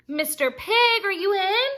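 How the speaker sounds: high, loud call through the door. Acted. Wolf calls to the second pig, high and loud. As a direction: sing out to the pig inside.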